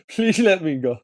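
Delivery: nervous laugh, pleading; laughing nervously while begging. Real, not acted. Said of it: "it's also a nervous laughter".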